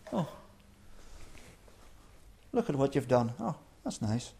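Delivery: soft, quiet mock admiration, patronizing; unimpressed God. God peering down at the proud builders, softly condescending. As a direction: pat them on the head, quietly unimpressed. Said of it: "He's not impressed".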